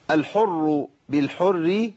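الحر بالحر slow, heavy r then light r; correct recitation. Shown as a demonstration. Teacher models the right reading, slow balanced الحر بالحر. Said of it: "أيوة كده، حلو قوي".

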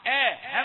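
آ of أعطى said thin and light; light hamza. Shown as a demonstration. Teacher isolating the thin hamza. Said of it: "همزة رقيقة".

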